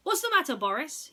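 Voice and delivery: a demanding boss calling out; Mitchell Junior. Acted. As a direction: boss calls out loud to nervous Boris, sharply. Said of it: "he asked".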